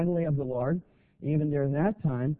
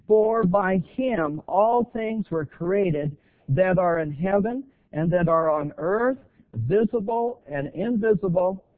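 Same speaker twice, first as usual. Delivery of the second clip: slow, louder solemn Bible reading; scripture reading; 4 semitones higher, 5 dB louder, slower than usual. Acted. Pastor reading Colossians to the church, slow and loud. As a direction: proclaim each phrase slowly.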